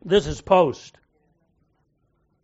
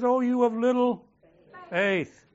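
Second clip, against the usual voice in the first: a lightly voiced Jesus, pitched higher; quoting Jesus; 7 semitones higher, 4 dB louder than usual. Pastor quotes Jesus' true words, lightly voiced higher. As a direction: lift the voice a little for the real words. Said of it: "He says".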